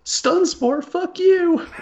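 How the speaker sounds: mocking nerd voice; high squeaky taunt. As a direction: taunt the other player in a high voice. Acting a player at the table.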